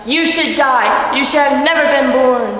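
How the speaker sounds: slow, loud, cruel hallucinated voice; menacing inner voices. A narrator's reading. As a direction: loom over her and condemn. Hostile voices in her head.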